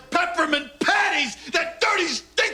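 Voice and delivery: sudden angry shout, pitch jumping up; furious yell at the cookie jar; angry outburst. Acted. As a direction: blow up mid-rant at the cookies. Dad rants about Girl Scout cookies.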